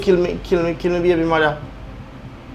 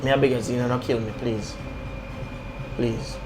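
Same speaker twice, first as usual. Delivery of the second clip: begging for life; 8 semitones lower, 6 dB quieter than usual. Acted. Toby begs for his life.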